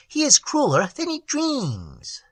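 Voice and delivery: tiny troll voice. A narrator's reading. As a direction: sigh it like a sad tiny sprite.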